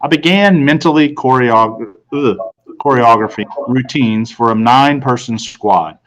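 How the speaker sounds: usual speaking voice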